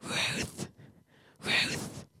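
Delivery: insistent whispered name-calling, softly repeated; pestering whisper. Retold or imitated. Jenny pesters the speaker awake, in an imitated whisper. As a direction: imitate someone whispering to wake a sleeper. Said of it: "with her pestering".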